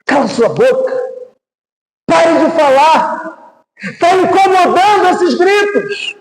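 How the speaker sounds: high, insistent rebuke in a preacher's retelling; acted scolding. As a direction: act out hushing someone, rising in pitch. Acted bystander silencing the blind man.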